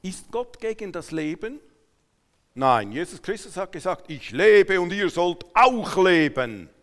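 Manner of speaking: usual speaking voice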